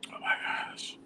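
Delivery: breathy whisper while choked up; hushed, teary whisper. Real, not acted. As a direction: whisper it, collecting yourself through tears. Composing self after crying.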